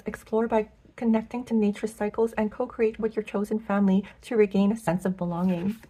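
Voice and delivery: ordinary talk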